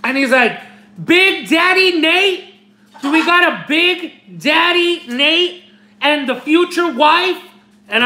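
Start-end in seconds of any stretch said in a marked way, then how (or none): 1.0-7.4 high, drawn-out imitation of a booming clerk; loud burly call-out. Imitating a postal clerk calling out a package, high and drawn out. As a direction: holler it across a packed post office. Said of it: "in a loud ... with a big, like, burly voice".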